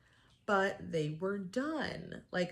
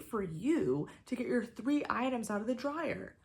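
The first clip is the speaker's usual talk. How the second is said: heated rant; 3 semitones higher than usual. Real, not acted. Vlogger lecturing the laundromat lady, heated and rising. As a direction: let the outrage build and lecture her. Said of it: "ranting at the rude lady".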